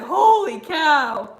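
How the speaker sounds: loud, high, drawn-out exclamation; amused disbelief. Retold or imitated. Reacting to a hilarious rant, amused disbelief. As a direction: blurt it out big and slow.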